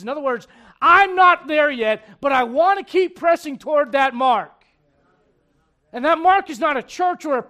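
loud, high, driving sermon voice; full-force preaching. Acted. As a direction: preach it out to the whole church.

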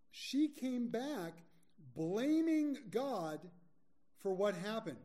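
slow, high and loud emphasis; emphatic. Real, not acted. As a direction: lean hard on every word. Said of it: "preacher emphasising blame".

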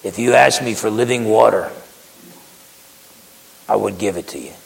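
Jesus speaking gently to the Samaritan woman, tender; gentle. Retold or imitated. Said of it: "Jesus was very gentle with her".

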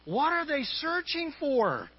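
high-pitched exasperated question; frustrated. Acted. Boss at his wits' end with the child, frustrated. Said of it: "totally frustrated".

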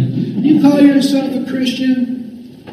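high, drawn-out accusing voice; Satan. Acted. Devil pointing his bony finger at a believer, high slow taunt. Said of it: "probably something like that".

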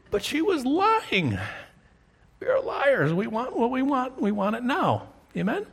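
revival-style climax; fervent preaching. Real, not acted. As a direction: preach it home with intensity. Preacher's high-pitched climax.

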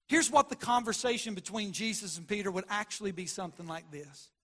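ordinary talk

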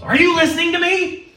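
very high-pitched mock outcry; exasperated demand. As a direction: burst out as if you've been ignored. Acts an ignored person's demand.

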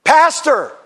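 high, loud call for the pastor. Retold or imitated. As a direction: imitate someone hailing their minister. Acts out people calling him by title.

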